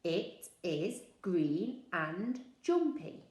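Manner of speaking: slow, word by word; slow, even repetition; slow rehearsal. Shown as a demonstration. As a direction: rehearse the sentence slowly. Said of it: "I said it a few times".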